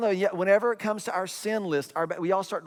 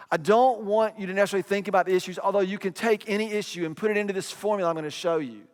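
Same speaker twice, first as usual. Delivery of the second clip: ordinary talk, nothing marked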